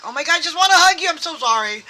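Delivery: high, loud and very fast, gushing sorry; gushing apology. Retold or imitated. Imitating someone who suddenly understands, gushing high, loud and fast. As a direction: blurt it out in a rush of affection. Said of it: "You're just like".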